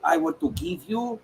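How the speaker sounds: light mock French accent as the composer; playful French accent voicing the composer. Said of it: "I don't know why I gave him a French accent".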